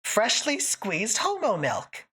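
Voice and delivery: ordinary delivery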